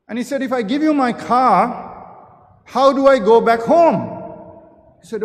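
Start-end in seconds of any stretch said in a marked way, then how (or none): 0.4-4.0 loud protest. Acted. Buyer balks at giving up his car, loud and indignant. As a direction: push back hard on the price, voice raised.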